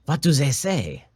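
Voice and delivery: slow, stagey fake German accent; drawn-out German accent, like a forties actor; bad German accent. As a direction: do a hammy old-movie German. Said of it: "doing a bad German accent".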